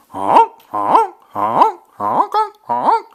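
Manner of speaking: playful goose impression, loud and high; goose honks. Shown as a demonstration. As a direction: honk like a goose for a toddler. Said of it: "I'll do the sound".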